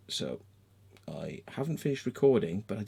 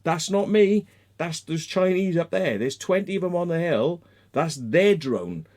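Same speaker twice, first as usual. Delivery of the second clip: raised, insistent protest; higher, louder retold self-defence; retold emphatic denial; 7 semitones higher, 5 dB louder than usual. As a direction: protest loudly to clear your name. Retold protest to police officer.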